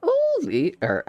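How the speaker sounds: a high voice started by mistake; accidental high character voice; wrong voice. Acted. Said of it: "sorry, wrong voice".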